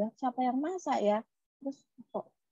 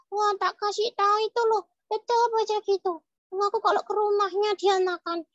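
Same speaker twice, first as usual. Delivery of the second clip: put-on high childlike character voice; puppet child tattling; 10 semitones higher, 5 dB louder than usual. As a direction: tell tales as an indignant little puppet. (suara boneka anak mengadu)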